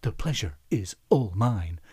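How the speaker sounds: a hint of Shatner's measured Kirk delivery. Quizmaster retelling meeting Shatner, light Kirk impression. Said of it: "in his best Kirk-like voice".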